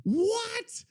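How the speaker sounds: high, drawn-out bewildered wail; slow down and whine it in disbelief; real baffled despair; exasperated disbelief. Real, not acted. Bewildered by the failing voice clip.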